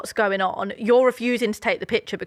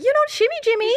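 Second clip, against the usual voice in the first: very high, loud mimicked film character; film lady's tone; 4 dB louder, faster than usual. Imitating the lady from Blended, high-pitched mock voice. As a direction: squeal it like the woman in the film. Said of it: "coming out in that tone".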